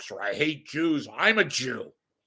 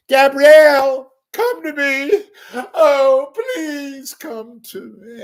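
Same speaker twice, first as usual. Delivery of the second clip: much higher, slow, hammed-up crooning plea; mock pleading lover; 12 semitones higher, 9 dB louder, much slower than usual. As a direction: ham up a lovesick begging call. Pleading to celebrity crush.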